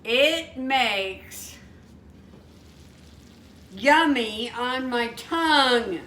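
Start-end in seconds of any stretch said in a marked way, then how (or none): none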